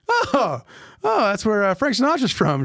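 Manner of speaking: high, loud mock-impressed reaction; delighted recognition. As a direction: act out a sudden happy realisation. Acting his reaction to Hoboken.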